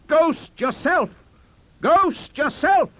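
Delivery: high, loud, drawn-out shriek; screamed retort. A narrator's reading. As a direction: hurl the insult straight back, screaming. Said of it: "I screamed".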